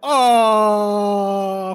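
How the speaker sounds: loud collective dismayed "ah"; crowd groan. Acted. GM voicing the crowd reacting to bad news, loud groan. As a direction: let out the whole crowd's groan.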